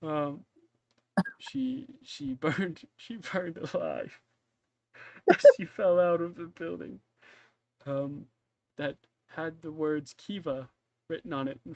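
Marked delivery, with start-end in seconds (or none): 1.4-4.2 high, slow, breathless giggling; can barely get it out for laughing; laughing through words. Real, not acted. GM cracking up while voicing the widower's toast, laughing. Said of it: "I'm crying". 5.6-7.0 laughing. Real, not acted. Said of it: "I'm crying".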